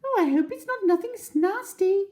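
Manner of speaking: very high, lilting bird call; roly-poly bird singing hello; sing-song bird. Acted. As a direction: chirp a cheerful greeting. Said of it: "sang the roly-poly bird".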